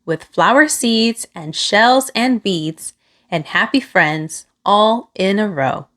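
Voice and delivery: ordinary delivery, nothing marked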